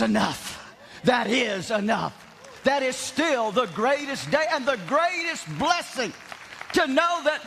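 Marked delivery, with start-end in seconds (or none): 2.6-7.4 raised, ringing sermon voice, higher than his chat; preaching. Acted. Pastor preaching to his congregation, in a raised ringing preaching voice. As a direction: preach it out to the church with full conviction.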